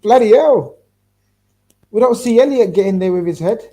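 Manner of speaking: loud, high exasperated outburst; yelling at the tv. Real, not acted. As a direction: blurt it out at the screen. Reacting loudly to near goal.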